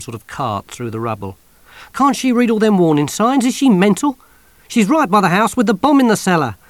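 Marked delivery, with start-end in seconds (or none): 1.9-6.6 high, loud disbelief; aghast at the old woman's danger; alarmed soldier. Acted. Soldier seeing her near the bomb, alarmed and loud.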